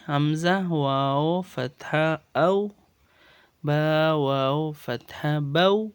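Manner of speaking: slow, deliberate Arabic spelling, each syllable drawn out; slow spelling recitation. Shown as a demonstration. Teacher shows students how to pronounce waw leen, slow and deliberate. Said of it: "تو واو لین کا pronounce کیسے ہے؟".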